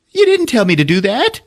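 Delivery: high-pitched indignant whine; protesting character. Retold or imitated. Grease Monkey protests, then louder.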